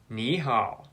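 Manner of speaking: Ni rising, hao low; third tone sandhi. Shown as a demonstration. Teacher demonstrates Ni hao with tone change. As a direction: say Ni with a rise then a low hao. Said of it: "rising tone into a low tone".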